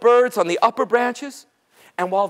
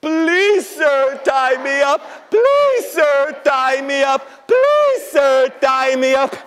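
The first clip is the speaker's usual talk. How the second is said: crying plea; 3 semitones higher, 4 dB louder, much slower than usual. Acted. Little creatures begging to be tied up, wailing. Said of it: "they all start crying like this".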